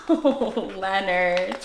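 usual speaking voice

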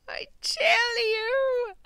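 high, loud, drawn-out shout; shouted vow. Real, not acted. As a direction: belt it out slowly.

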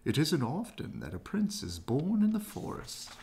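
put-on a stately old owl greeting; owl's voice. A narrator's reading. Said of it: "said the owl to the doe".

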